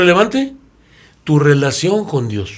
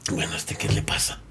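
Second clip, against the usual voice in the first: hushed, whispered puzzlement; whispered aside; 11 semitones lower, 13 dB quieter than usual. Acted. The businessman whispering to himself about the crying woman. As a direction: mutter it under your breath, baffled.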